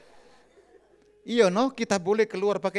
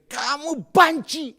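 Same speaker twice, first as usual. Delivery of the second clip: annoyed wife; 8 semitones higher, 6 dB louder, much slower than usual. Retold or imitated. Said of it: "Terakhir dia jengkel".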